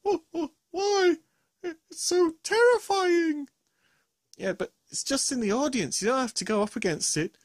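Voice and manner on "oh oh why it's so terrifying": high, slow, wailing monster; whiny fright. Acted. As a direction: whimper it like a big coward. Gruffalo whines about the mouse.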